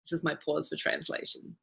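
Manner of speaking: very soft, low aside; hushed aside. Real, not acted. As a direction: drop right down while the translator takes over. Quiet aside while waiting for translator.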